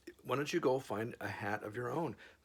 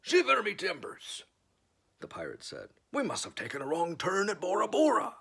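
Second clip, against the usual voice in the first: pirate exclamation; 9 semitones higher, 4 dB louder than usual. Acted. Pirate voice in story.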